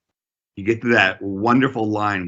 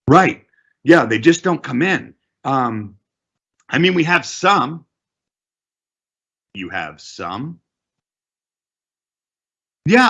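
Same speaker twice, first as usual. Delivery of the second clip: higher, louder, eager manager; manager's voice; 6 semitones higher, 3 dB louder than usual. Acted. [name] answering the agent, higher and louder. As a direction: play the manager blurting out numbers.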